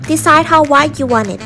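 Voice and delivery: ordinary talk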